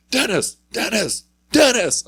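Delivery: high, loud calls of a name across a lot; calling out. Retold or imitated. Kid behind her calling her name to get her attention.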